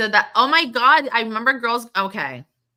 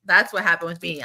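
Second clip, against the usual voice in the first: ordinary talk, nothing marked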